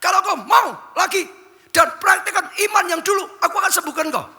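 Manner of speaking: high, loud promise of healing; God's promise. Acted. God promising healing to the sick pastor, loud high proclamation. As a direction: proclaim it over him. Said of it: "Tuhan berkata".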